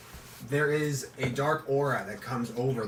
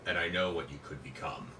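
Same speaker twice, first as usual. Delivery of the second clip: deep booming voice; 6 semitones lower, 8 dB quieter than usual. Acted. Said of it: "The voice says".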